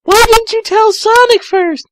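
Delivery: put-on very high, loud girly voice; high Amy. Amy questioning Tails, very high girly voice. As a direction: ask it in a squeaky girl voice. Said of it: "Amy asked".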